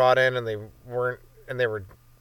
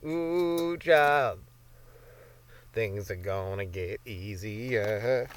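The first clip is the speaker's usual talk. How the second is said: slow, soft, lower reassuring croon; put-on soothing voice; 4 semitones lower, 5 dB quieter, much slower than usual. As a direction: comfort a child in a knowing drawl. Sudden put-on comforting voice.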